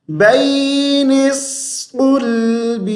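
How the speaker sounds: بین الصلب chanted high and slow, full ṣu; heavy saad. Shown as a demonstration. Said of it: "موٹی آواز".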